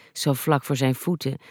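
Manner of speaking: ordinary delivery, nothing marked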